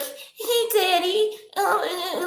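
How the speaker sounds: put-on little girl voice. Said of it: "like, little girl, like".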